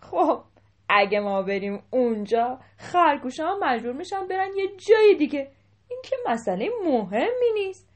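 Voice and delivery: loud scoff climbing high; loud, dismissive. A narrator's reading. Elephant king waving away concern for the rabbits, loudly dismissive. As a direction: brush the worry off at full volume. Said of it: "قاه قاه بلند خندید و گفت".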